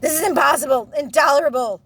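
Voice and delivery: loud, high exasperated outburst; outraged roar. Acted. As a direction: rage at the unbearable situation. Bear tries to roar.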